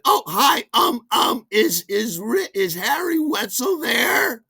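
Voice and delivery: high, loud mock sister on the intercom; imitating his sister. His sister calls up through the intercom, high and flustered. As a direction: squeak it out like a flustered kid sister. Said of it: "She said".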